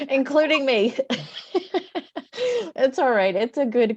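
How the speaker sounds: real laughter breaking into the words; teary laugh. Real, not acted. Host admitting she cried too, teary laughing. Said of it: "everyone on the call crying".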